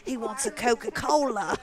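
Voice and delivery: mock Robert Irwin, excited Australian; Robert Irwin impression. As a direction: gasp at the snake like a nature host. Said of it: "Robert Irwin's TikToks".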